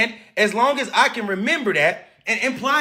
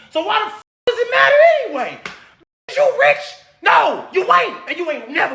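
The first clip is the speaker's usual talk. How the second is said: furious shout; 7 semitones higher than usual. Real, not acted. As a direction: scream the answer at the chat. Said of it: "'cause I'm so mad right now".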